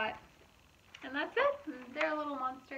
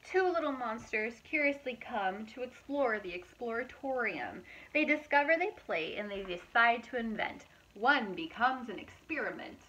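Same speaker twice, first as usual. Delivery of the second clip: lively, louder storybook narration; reading to children; 6 dB louder than usual. A narrator's reading. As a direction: read it out brightly to a young audience. Reading picture book aloud.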